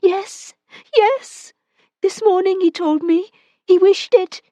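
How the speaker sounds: woman gives way under his grip, pleading; frightened woman. Acted. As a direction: plead with him, afraid.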